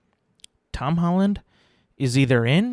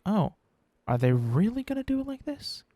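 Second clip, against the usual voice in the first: high-pitched incredulous question; skeptical, rising to a squeak; retold disbelief; 10 semitones higher, 3 dB quieter than usual. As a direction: voice climbing high in doubtful surprise. Retelling own sceptical reaction in theater.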